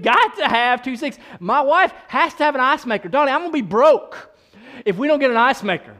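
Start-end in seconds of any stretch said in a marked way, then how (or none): none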